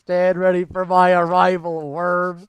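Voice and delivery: loud menacing villain line. Retold or imitated. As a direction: threaten like a villain. Quoting villain meme line.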